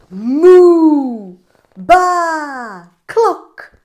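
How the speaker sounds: loud moo, baa and high cluck; animal chorus. Acted. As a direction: burst out with farmyard noises. Animals shouting at farmer.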